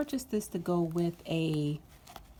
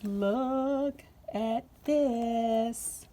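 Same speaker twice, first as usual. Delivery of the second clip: very slow, stretched in awe; slow delighted reveal; awed drawn-out; 3 semitones higher, much slower than usual. Real, not acted. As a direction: linger over each word, amazed.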